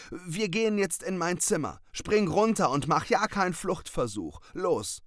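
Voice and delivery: high, loud orders; commanding cat. Acted. Cat ordering the raven to his room, high and loud. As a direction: bark the orders like a little boss.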